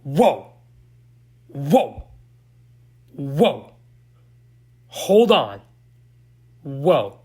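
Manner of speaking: spaced-out whoas, each swooping up and down; punchy re-enacted shock; stunned reaction. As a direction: act out shocked disbelief, pausing between words. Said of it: "I was like".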